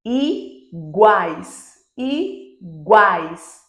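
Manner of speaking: "Iguais" split, i, then a long loud GUAIS; "Iguais" slowly, guais as one arched glide; triphthong demo. Shown as a demonstration. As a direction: pause after the i of "Iguais", then swell on a. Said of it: "o A sempre será vogal".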